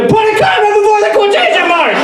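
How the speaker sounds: sudden high-pitched yell; frantic shout. Acted. Teacher reminding his class about punctuation, sudden frantic shout. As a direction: burst into a shrieked instruction.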